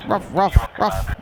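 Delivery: high-pitched imitation barking; short, high "ruff" noises; mock dog bark. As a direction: bark like a little dog, in mockery. Mocks an opponent as barking.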